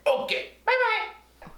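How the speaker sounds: very high, slow sing-song bye; banana puppet leaving the lesson; puppet goodbye. Acted. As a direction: wave it off like a puppet.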